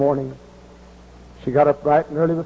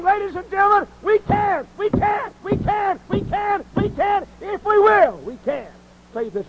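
much higher, hammering hellfire bellow; hollering preacher. Shown as a demonstration. Preacher bellowing hope at America, much higher and hammering. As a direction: pound each we can from the pulpit. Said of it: "stomping and hollering and screaming and bellowing".

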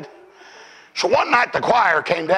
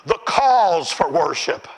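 ordinary talk, nothing marked

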